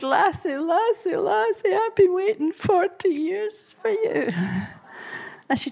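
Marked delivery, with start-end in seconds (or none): 0.0-4.3 put-on old Scottish lady climbing higher with each lassie; old lady rising. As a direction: let each lassie rise in joyful relief. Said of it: "And she said".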